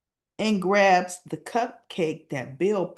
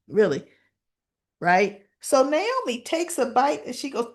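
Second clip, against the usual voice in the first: normal talking voice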